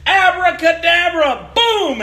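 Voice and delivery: drawn-out, grand magic word; mock magic incantation. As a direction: cast the spell like a kid. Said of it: "I went up there and I said".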